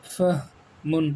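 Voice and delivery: فہمن drawn out very slowly as fah-mun; slow model reading. Shown as a demonstration. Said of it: "اس میں فرق ہے".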